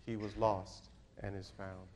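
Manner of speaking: very low, soft preacher's close; subdued, reverent scripture quote; hushed, solemn. Acted. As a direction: let each phrase settle quietly. Hushed closing quote of the father.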